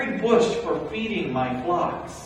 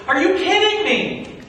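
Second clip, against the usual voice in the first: loud, higher, incredulous scolding; exasperated rebuke; 13 semitones higher, 6 dB louder than usual. Acted. Moses scolding two Israelites who are fighting, loud and exasperated. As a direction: call them out in disbelief.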